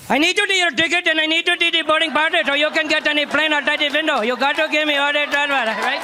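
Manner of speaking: goofy airport security boss voice; dim TSA guy. Retold or imitated. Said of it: "and he says".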